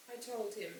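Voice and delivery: ordinary delivery, nothing marked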